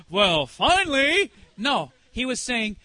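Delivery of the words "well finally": loud, slow, drawn-out sarcasm; mock impatience. Imagining God's reply to her, exaggerated impatience. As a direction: act out a put-upon "at last". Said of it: "he wasn't up there going".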